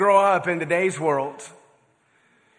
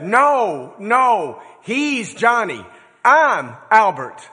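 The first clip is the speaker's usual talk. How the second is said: higher and louder, slow on "he's Johnny" and "I'm Albert"; exasperated correction; 5 semitones higher, 6 dB louder, much slower than usual. Acted. Frazzled dad in the park correcting the kind woman, louder and exasperated. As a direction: set her straight, worn out and at wit's end.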